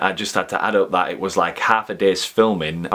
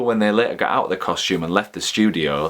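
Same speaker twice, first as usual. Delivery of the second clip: ordinary talk, nothing marked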